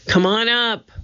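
high call at moderate volume; mild shout. A narrator's reading. Annie to her brother below the ladder, mild shout. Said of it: "Annie shouted".

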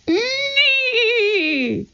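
very high, long-held whinny; drawn-out imitation neigh, falling at the end; horse whinny. A narrator's reading. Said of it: "A horse's whinny echoed".

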